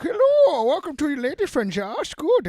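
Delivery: put-on high loud vague German accent; mock Hardy's odd Bronson accent; weird German accent. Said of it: "he's like".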